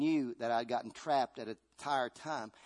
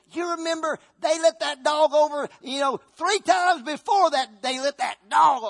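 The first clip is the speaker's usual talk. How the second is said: high, loud nagging voice; a loud voice dredging up old grudges; nagging memory voice; 12 semitones higher, 10 dB louder than usual. Acted. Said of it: "memory always said there".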